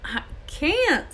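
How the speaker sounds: high, plaintive protest; pained refusal. A narrator's reading. Hagrid refuses to give up his dragon, pained.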